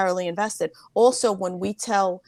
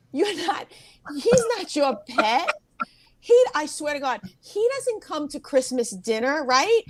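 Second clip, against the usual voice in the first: higher, emphatic reasoning; 6 semitones higher than usual. Retold or imitated. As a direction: talk sense into a weepy relative. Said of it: "the aunt that talks like you".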